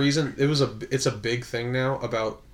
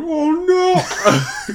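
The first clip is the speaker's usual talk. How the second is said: loud, high hammed-up wail; mock dismay; 6 dB louder than usual. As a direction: cry out in exaggerated horror, half laughing. Acts dismay at losing his bite.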